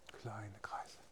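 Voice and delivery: whispered cue. Shown as a demonstration. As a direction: breathe the words softly. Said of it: "whispered echo".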